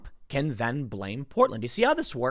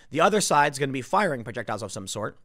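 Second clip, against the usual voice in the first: ordinary talk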